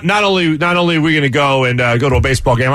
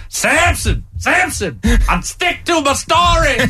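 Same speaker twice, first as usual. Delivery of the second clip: high, slow laughing movie voice; movie quote voice; 11 semitones higher, slower than usual. Retold or imitated. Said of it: "is saying".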